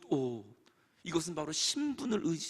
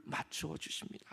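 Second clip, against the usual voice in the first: hushed whisper; 6 semitones lower, 13 dB quieter than usual. A narrator's reading. Tender kiss in the parable.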